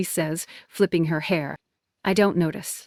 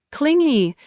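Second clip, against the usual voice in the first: "Clingy?" thrown out higher and louder in disbelief; shocked echo; 8 semitones higher, 5 dB louder than usual. A narrator's reading. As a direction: repeat clingy, stunned and hurt.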